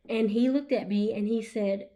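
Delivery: usual speaking voice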